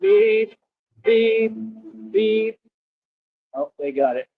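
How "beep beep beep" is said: high mock beeps like a car alarm; imitating car alarm. Streamer mimicking a car alarm, high mock beeps. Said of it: "That's a car alarm".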